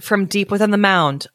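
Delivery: plain reading voice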